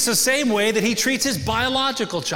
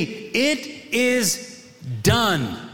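slow solemn reading; much slower than usual. Acted. Preacher savours the final words.

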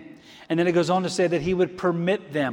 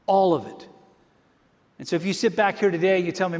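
usual speaking voice

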